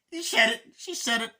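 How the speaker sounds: very high, loud, thrilled cry; excited outburst. Retold or imitated. Reviewer reacting to the title line, shouting with delight. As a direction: yell it like the line just made your night. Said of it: "And I just went".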